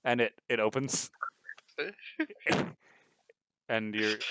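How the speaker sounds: ordinary talk, nothing marked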